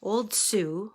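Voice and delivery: plain reading voice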